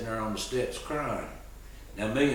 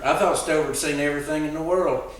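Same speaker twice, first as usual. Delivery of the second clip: ordinary talk, nothing marked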